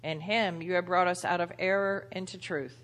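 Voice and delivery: ordinary talk, nothing marked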